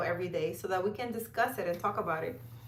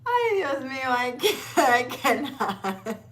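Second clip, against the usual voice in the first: slow, laughing through every word; can't stop laughing; real giggling, breathless from laughter; laughing helplessly; 4 semitones higher, 4 dB louder than usual. Real, not acted. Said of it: "I'm over here crying".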